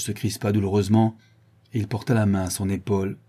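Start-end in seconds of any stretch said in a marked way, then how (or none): none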